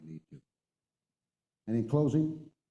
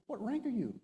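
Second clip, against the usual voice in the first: high-pitched retold astonishment; incredulous question; 11 semitones higher than usual. Enlisted man finding out the officer's rank, incredulous. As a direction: ask it in mock alarm.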